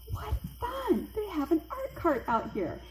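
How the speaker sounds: high-pitched, delighted exclamation; retold excitement. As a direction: act out gleeful surprise at a fun discovery. Excited discovery of art cart, retold.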